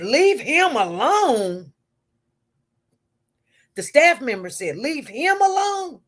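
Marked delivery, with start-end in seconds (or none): 0.0-1.7 slow, drawn-out disbelieving repeat, louder; incredulous echo. Retold or imitated. Staff member echoing the nurse, mock outraged disbelief. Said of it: "he couldn't believe I said". 4.8-6.0 higher, slowed indignant echo; mock outraged repeat. Staff member hollering back at the nurse, hammed-up indignation. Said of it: "he's hollering".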